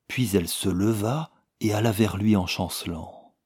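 ordinary delivery

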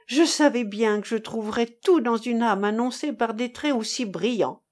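plain reading voice

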